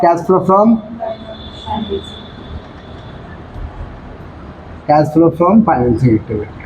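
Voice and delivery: words stretched out for note-taking; slow dictation. Shown as a demonstration. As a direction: dictate slowly so nobody falls behind. Teacher dictating heading to students. (धीरे-धीरे शब्द लिखवाना)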